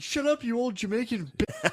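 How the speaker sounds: high-pitched mock outburst; imitated yelling. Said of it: "Joshua was yelling at the fu-, the Jamaicans".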